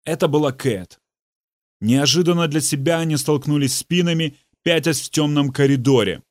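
ordinary delivery, nothing marked

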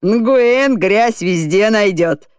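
slow mocking jibe; teasing, slow. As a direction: drag out the joke. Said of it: "сострил Шкаликов".

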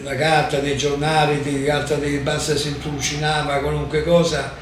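usual speaking voice

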